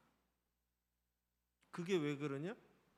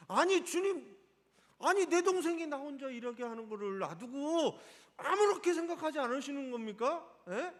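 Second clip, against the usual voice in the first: higher and louder, heated and indignant; exasperated protest, pitched up and loud; agitated complaint; 5 semitones higher, 5 dB louder than usual. Shown as a demonstration. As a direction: blurt it out at the Lord, worked up. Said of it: "약간 이렇게 격양돼서 하는 얘기예요".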